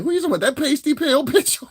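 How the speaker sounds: high-pitched mock outburst, amused; imitating dumb-loud woman. Imitating the Italian woman he was dating, dumb loud. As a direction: imitate her blurting it out brazenly. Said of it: "she said the shit dumb loud".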